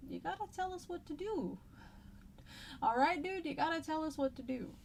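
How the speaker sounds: high-pitched, helpless plea; pleading to God. Acted. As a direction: plead like someone out of options. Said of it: "So it's basically saying".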